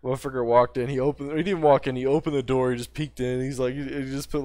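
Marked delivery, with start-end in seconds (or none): none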